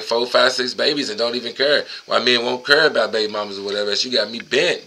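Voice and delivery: ordinary talk, nothing marked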